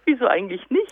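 words carried on a laugh. Shown as a demonstration. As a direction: ask it through laughter. Laughing while asking. (lachend gefragt)

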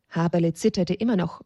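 plain reading voice

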